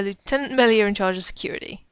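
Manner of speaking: usual speaking voice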